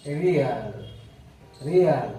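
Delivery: रियल said as ri-ul, falling at the end; careful pronunciation. Shown as a demonstration. Teacher modelling a word for students, careful. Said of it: "इसे बोलते हैं".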